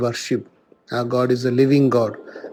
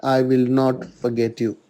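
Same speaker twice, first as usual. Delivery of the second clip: measured, slightly raised reading voice, unhurried; solemn scripture reading. Acted. Priest reading Isaiah aloud to the congregation, solemn and measured. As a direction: proclaim the prophet's words to the congregation.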